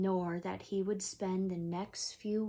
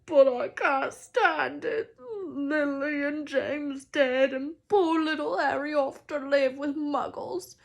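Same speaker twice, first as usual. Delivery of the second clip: higher, louder, slow crying lament; tearful wail; 7 semitones higher, 9 dB louder than usual. Acted. Hagrid crying to McGonagall over poor Harry, wailing. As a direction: cry it out, heartbroken.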